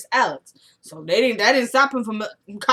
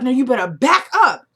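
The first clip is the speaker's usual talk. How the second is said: retold warning; 4 semitones higher, 8 dB louder than usual. Rusty warns Randall off.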